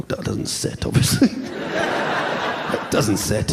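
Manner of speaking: unvoiced, very slow whisper; hushed, drawn-out whisper; hushed aside. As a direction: whisper it like a spooky secret. Hushed aside about baby.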